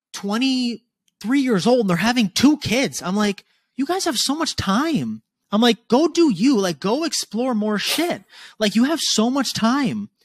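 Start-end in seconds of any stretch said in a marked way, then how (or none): 3.8-10.1 high, slow and insistent, pressing each point; emphatic urging. Retold or imitated. Talking to young parents, urging them to enjoy their time, high and slow.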